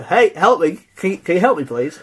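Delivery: loud, high call for help; mock frantic shout, pitch jumping up; desperate plea. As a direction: yell for help like a naked stranger in town. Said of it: "walked into town butt naked and go".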